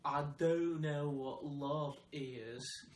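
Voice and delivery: slow drawn-out Forrest Gump line; Forrest Gump impression. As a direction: mimic the movie line slowly.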